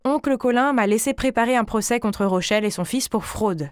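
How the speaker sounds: ordinary delivery, nothing marked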